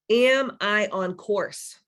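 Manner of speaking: slow, drawn-out self-question; slow reflective question. Shown as a demonstration. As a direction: ask it slowly, letting it sink in. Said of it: "We're gonna do some guided meditation".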